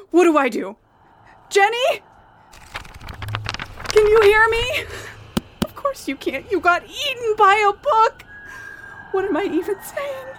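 high frantic panicked voice; calling out in panic. Acted. Friend swallowed by book.

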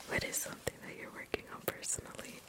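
barely audible whispered reading; thinking aloud in a faint whisper; whispering to self. Real, not acted. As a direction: reread it under your breath. Rereads the question to self.

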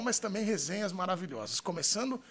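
ordinary talk, nothing marked

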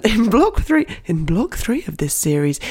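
mild mock listener voice; impression of the listener. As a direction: play the listener asking with a giggle. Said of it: "this is my impression of you".

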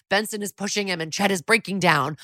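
ordinary delivery

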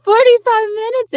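loud, high startled echo; alarmed disbelief. Retold or imitated. Kid hearing the shopping time limit, alarmed disbelief. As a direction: re-enact panicked surprise at a deadline.

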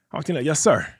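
ordinary talk, nothing marked

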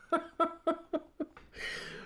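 high-pitched chuckling; laughing. Real, not acted. Laughing at retold knife remark.

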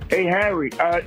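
usual speaking voice

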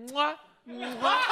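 three quick European kisses; big wet smooch. Shown as a demonstration. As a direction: triple cheek kiss, laughing. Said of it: "instead of doing mwah, you do".

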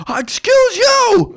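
high, slow offended screech; indignant stranger. Retold or imitated. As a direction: squeal it like an offended commuter.

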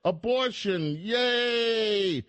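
mock celebrants working up to a shrill-free high cheer; mock victory cheer. Host mimicking liberals cheering about November, building to a high mock yay. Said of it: "And they said".

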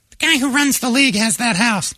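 high falsetto female impression; Megan. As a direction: explain it in a put-on high voice. Imitates Megan explaining.

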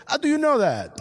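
mock a local's high-pitched astonishment; astonished French fan. French fan quizzing the visiting American, astonished.

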